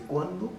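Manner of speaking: "cuándo" pitched sharply up; enfático. Shown as a demonstration. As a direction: say "cuándo" as an emphatic question word, rising high. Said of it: "porque es enfático". («cuándo» enfático, con tono alto y ascendente)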